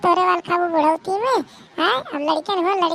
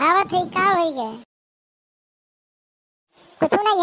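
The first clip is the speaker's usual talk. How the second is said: normal talking voice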